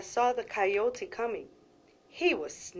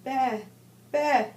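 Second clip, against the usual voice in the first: high little bleat; higher, louder bah bah of a lamb; 5 semitones higher, 5 dB louder than usual. A narrator's reading. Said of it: "Borreguita bleated".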